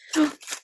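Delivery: quick high gasp; sudden excited intake of breath; surprised gasp. Real, not acted. Surprise at Oompa Loompa washi.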